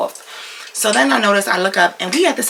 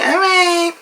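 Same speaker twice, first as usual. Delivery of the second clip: high-pitched, sing-out agreement; placating brush-off; 11 semitones higher, 4 dB louder than usual. Retold or imitated. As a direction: chirp it high to get rid of him. Fake agreement to get rid of him.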